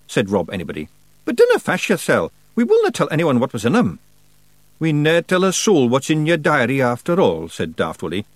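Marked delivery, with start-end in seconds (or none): 1.2-7.5 high, loud Scots character voice; cheeky wee rogue offering assurance; reassuring Feegle. A narrator's reading. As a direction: brush off the worry in broad Scots.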